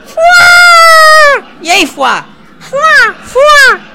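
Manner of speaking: very high loud yelp; shrieked fuá. Acted. Character yelps its catchphrase fuá. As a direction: yelp the nonsense word like a cartoon.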